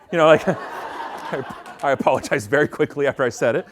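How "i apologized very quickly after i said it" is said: normal talking voice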